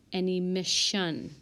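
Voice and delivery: normal talking voice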